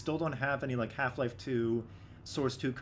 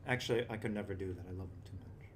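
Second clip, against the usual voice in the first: tender; 7 semitones lower, 7 dB quieter than usual. Real, not acted. Taking back the threat to the bird.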